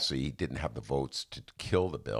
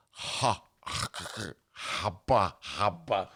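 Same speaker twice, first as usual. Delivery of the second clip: flustered babbling; 4 semitones higher, much slower than usual. Retold or imitated. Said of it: "and I'm like".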